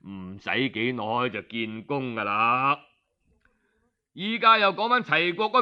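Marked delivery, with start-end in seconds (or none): 0.0-2.8 chuckling quietly. Acted. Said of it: "寨主就咪咪笑".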